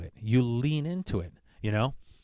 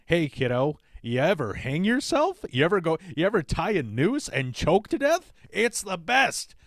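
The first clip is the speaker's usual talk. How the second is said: higher, sing-song mock mother to a child; mock cheerful mom; 6 semitones higher than usual. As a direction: cheerfully pitch suicide to a kid. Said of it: "Like we do to children".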